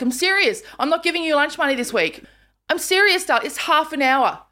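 high, insistent telling-off; mock-stern mum. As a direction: lay down the law, slowing at the end. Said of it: "I'm like".

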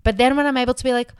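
normal talking voice

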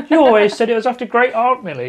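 put-on high, loud little-girl accusation; mock daughter voice. Future daughter confronting mum about the name, mock indignant.

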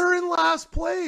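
slow, high disbelief at a teammate; high, slow "they're in last place" in disbelief; incredulous. Real, not acted. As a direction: drag it out, stunned it could be true. Arguing about the standings.

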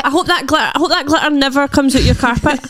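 laughing while talking. Real, not acted. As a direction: giggle through the words. Laughing at glitter mess.

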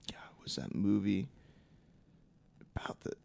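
breathy whisper while trying to recall; whispering to himself. Real, not acted. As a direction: whisper it under your breath. Searching memory, muttering to himself.

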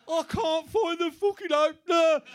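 desperate for the wine. Retold or imitated. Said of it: "He's like".

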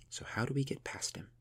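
breathy unvoiced whisper. Yareth asking Gunhild how to escape, whispered. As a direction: hush it so the guard won't hear. Prisoners plotting near a guard.

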